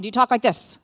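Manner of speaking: loud demo of a frustrated talker; impatient, frustrated. Shown as a demonstration. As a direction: punch it out with mock impatience. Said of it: "Are you impatient or frustrated when you're talking?".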